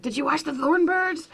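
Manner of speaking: light grandma voice asking again; imitating grandma. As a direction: pester like a grandmother. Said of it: "every time she's like".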